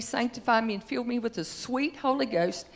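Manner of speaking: ordinary delivery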